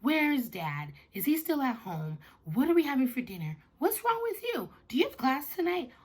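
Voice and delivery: put-on high, insistent kid firing questions; questioning daughter. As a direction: pepper Mom with questions, pitch climbing as you go. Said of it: "asking me all of these questions right away".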